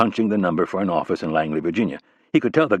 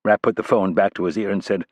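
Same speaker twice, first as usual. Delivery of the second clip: usual speaking voice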